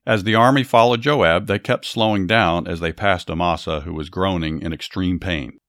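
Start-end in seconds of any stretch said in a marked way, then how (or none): none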